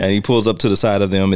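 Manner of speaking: usual speaking voice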